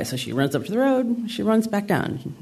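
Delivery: ordinary delivery, nothing marked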